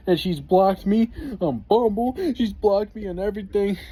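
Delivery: high, whining complaint of an upset buddy; whiny friend. Retold or imitated. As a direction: whine about being blocked, pitched high. Friend complaining about the woman.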